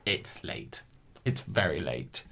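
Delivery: dropping to a low, soft mumble; tired excuse. Real, not acted. Excusing her mistake, softly and tired. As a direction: sink down, it's late. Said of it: "My, my defense".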